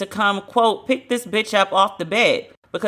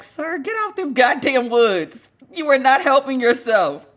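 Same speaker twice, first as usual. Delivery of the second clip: higher, exasperated mock telling-off; exasperated scolding; 5 semitones higher than usual. Podcaster telling off a rapper for filming in the woods, exasperated. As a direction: scold him in comic disbelief, drawing it out.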